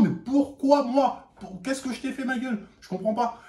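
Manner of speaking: high, slow, plaintive; mildly mocking hurt plea; hurt betrayal. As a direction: plead like a betrayed friend, a bit hammed. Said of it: "c'était vraiment".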